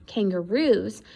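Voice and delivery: ordinary delivery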